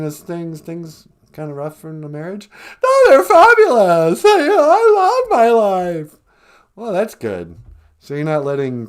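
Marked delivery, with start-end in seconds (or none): 2.8-6.2 much higher, louder, gushing caricature of Megan; mock Megan voice. Host plays Megan on the phone, high gushing squeal. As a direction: squeal it like an overexcited fan. Megan voice insists all fine.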